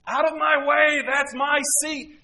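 loud, high, slow shouted demand; pushy grab for the last seat; selfish shout. Retold or imitated. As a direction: bark it like someone grabbing a seat. Selfish person grabbing the seat.

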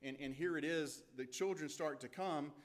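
ordinary delivery

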